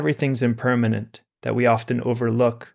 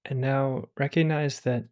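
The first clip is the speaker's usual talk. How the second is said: calm, unhurried meditation voice with long pauses; guided meditation; 3 dB quieter than usual. Acted. Teacher guiding listeners through meditation, calm and unhurried. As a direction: ease listeners into stillness.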